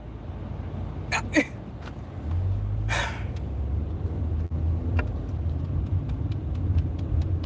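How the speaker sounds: long weary exhale; frustrated fan sighing. Real, not acted. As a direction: let out a long tired sigh.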